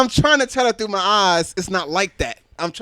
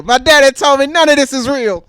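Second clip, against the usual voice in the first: put-on bragging kid; 6 semitones higher, 6 dB louder than usual. Son bragging at school.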